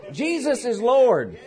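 ordinary delivery

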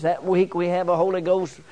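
ordinary delivery

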